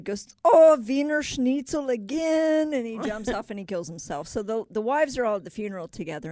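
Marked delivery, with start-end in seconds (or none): 0.4-2.7 slow, high, loud German wail; hammed-up German-accented dismay; bad German accent. German worker opening lunchbox to schnitzel, exaggerated German accent. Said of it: "that's a bad German accent".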